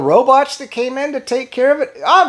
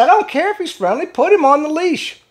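retold firm retort; 7 semitones higher than usual. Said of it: "I said".

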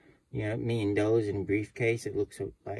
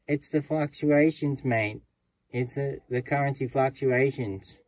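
Aussie bank teller; 5 semitones higher, 5 dB louder than usual. Retold or imitated. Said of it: "And the guy goes".

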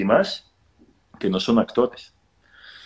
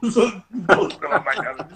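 laughing; 9 semitones higher, slower than usual. Real, not acted. Teller laughs at the late hour.

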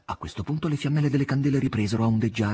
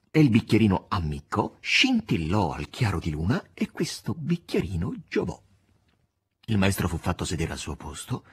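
plain reading voice

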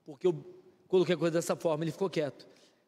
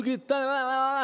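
normal talking voice

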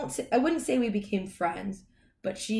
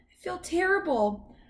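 high-pitched talking dog; high, earnest dog voice; apologetic dog; 7 semitones higher, 5 dB louder than usual. A narrator's reading. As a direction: plead to make amends in a high puppyish voice. Dog pleads to make amends.

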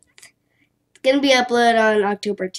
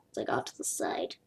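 whispered aside; 13 semitones lower, 17 dB quieter than usual. Real, not acted. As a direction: whisper it under the breath. Repeated under breath.

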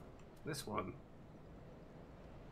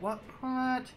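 surprised; 11 semitones higher than usual. Real, not acted. Summon sign vanished suddenly.

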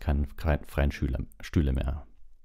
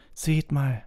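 high, sudden call for attention; 10 semitones higher than usual. A narrator's reading. Ron points out of the window, sudden. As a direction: break in with a higher voice. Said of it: "sagte Ron plötzlich".

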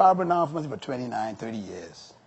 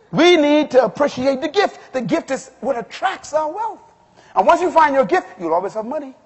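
high, loud, emphatic preacher; rousing sermon voice; full-force preaching; 9 semitones higher, 7 dB louder than usual. Real, not acted. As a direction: proclaim it to the congregation.

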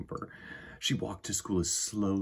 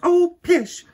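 very high, loud, slow sweet welcome; loving mother; 12 dB louder than usual. Acted. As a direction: sing out a welcome to her child. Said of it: "said her mother".

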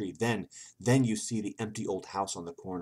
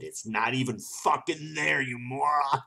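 higher, louder exasperated scolding; mock angry outburst; 7 semitones higher, 6 dB louder, slower than usual. Player scolding over a valentine that vanishes, mock anger. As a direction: scold with comic outrage.

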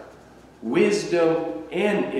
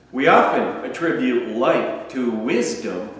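ordinary delivery

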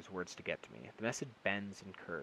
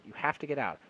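loud, higher urgent shout; 7 semitones higher, 8 dB louder than usual. A narrator's reading. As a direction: drive him out with a yell. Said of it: "I shout".

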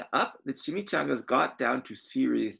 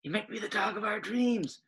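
whispered; 9 semitones higher, 5 dB quieter than usual. Acted. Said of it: "whispered Rosalita".

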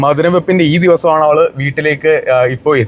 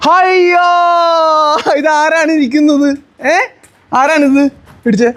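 high, drawn-out cooing greeting; 10 semitones higher, slower than usual. Real, not acted. Uncle greeting his little nephew after a long time, delighted baby talk. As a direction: coo high in pretend surprise at who is here.

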